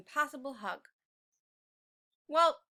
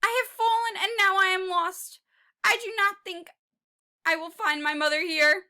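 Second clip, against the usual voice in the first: louder, higher lost little dinosaur; lost Tiny; 6 semitones higher, 10 dB louder than usual. Acted. Tiny T-Rex lost in a desk drawer calling. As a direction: call out worried and lost.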